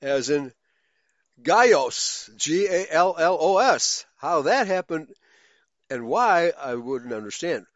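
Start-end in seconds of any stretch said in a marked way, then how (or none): 1.4-2.3 "Gallos" with LL as a Y, GAI-yos; Spanish double L. Shown as a demonstration. Teacher giving a Spanish example, "Gallos" with a Y sound for LL. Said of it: "the Y sound moved".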